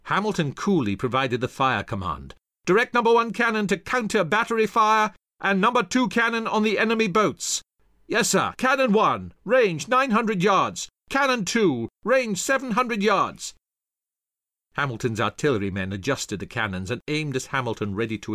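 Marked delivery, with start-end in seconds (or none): 2.6-7.6 high, projected military order; raised, sustained officer's voice; fire command. A narrator's reading. 8.1-13.5 high, projected gunner's call; raised military calling voice; calling out ranges. A narrator's reading.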